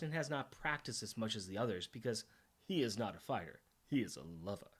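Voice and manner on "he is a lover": mock suave. Joking aside, then laughs.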